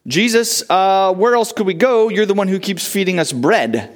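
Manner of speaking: a lightly voiced shrug of a follower; wrong answer. Preacher voicing Peter's wrong answer, lightly mocking. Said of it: "He doesn't say".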